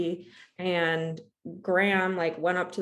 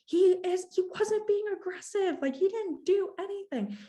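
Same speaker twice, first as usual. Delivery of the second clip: mock scream back; 13 semitones higher than usual. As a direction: shriek it back in defense of the dog. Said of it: "so I'm screaming back".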